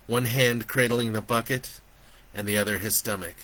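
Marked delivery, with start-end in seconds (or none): none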